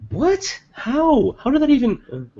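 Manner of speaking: incredulous, very high squeak; real astonishment. Real, not acted. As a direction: voice leaps sky-high in disbelief. Player astonished by the dice roll.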